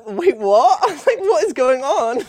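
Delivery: high, loud mock bewilderment; baffled reaction. Viewer reacting to a friend's video, hammed-up bafflement. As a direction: retell it in loud, laughing disbelief.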